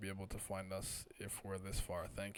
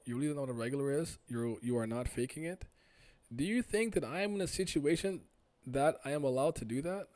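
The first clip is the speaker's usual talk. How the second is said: louder, insistent acted dialogue; agitated characters; 4 semitones higher, 8 dB louder than usual. Stranger insists to doubting questioner it's true, agitated acted dialogue. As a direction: plead and challenge, punching the key words.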